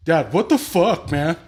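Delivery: loud, slow mock outrage; imitating angry son. Furious son yelling at his dad over the phone. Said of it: "and Eric's like".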